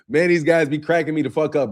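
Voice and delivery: ordinary talk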